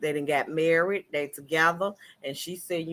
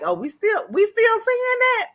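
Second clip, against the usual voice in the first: mock incredulity; 4 dB louder than usual. Vlogger voices the wife scoffing at the affair, mock incredulous. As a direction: shoot way up high on the scoffing question.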